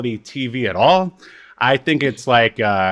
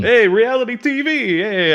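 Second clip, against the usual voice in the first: higher, put-on teasing hey; mock sultry hey; 11 semitones higher, 3 dB louder than usual. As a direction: tease it like a cheesy come-on. Mock greeting to reality TV.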